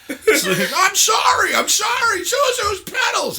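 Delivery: high, slow, shouted and cracking Jimmy Stewart bit; Jimmy Stewart impression. Friends joking about It's a Wonderful Life, high frantic shouted movie-hero voice. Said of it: "That was my best Jimmy Stewart".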